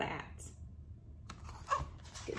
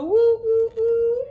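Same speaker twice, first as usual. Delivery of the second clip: loud, high woo woo like a train whistle; 10 dB louder than usual. Acted. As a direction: blow the whistle with your voice.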